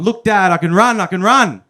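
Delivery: put-on excited baby horse child. Horse-baby son calls to his dad, high and loud with excitement. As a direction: show off to dad, thrilled.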